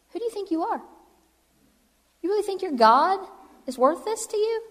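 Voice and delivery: high-pitched, incredulous challenge; indignant, scornful questioning; affronted king. Acted. Acting the affronted king challenging three boys.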